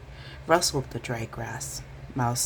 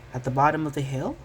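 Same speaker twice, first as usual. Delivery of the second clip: ordinary delivery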